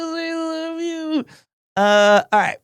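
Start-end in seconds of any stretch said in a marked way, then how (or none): 0.0-1.2 tender coo. Real, not acted. Cooing to baby photo.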